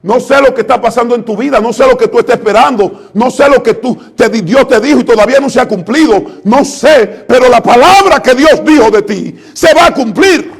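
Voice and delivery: ordinary talk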